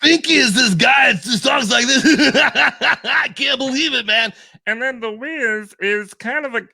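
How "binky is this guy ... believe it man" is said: high, loud crazed rocker shout; a much higher, much louder shouted cartoon voice; Binky voice. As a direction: yell it out like a wild party dude, laughing. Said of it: "ask you to do the Binky and Wiz voice".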